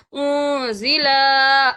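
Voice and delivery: اون ضلع with the vowel of ضلع dragged out long; ضلع held far too long; wrong stretched zi. Shown as a demonstration. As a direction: overstretch ضلع as the error. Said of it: "لام یوں نہیں پڑھنا".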